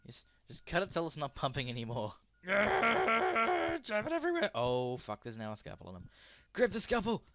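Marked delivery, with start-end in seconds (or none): 2.5-4.5 manic yell. Real, not acted. High, slow, far voice.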